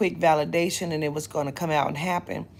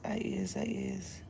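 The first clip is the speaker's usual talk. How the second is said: softer and softer to a whisper; fading whisper; 10 dB quieter than usual. Real, not acted. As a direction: let it die into a whisper. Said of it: "fading whisper".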